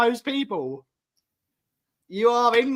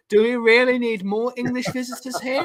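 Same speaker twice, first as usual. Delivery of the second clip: a hammed-up, higher, faster bad Scottish accent; mock Scottish grumble about English visitors, pitched up; 5 semitones higher, 3 dB louder than usual. Host mocks English visitors in a bad Scottish accent. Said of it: "That was a really bad, uh, accent".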